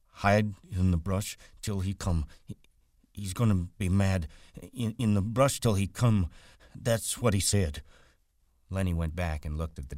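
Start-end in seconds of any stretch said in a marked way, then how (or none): 0.1-7.8 higher, halting Lennie figure; anxious simple man. Acted. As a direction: recite it haltingly, like a worried child. Lennie recalls instructions anxiously.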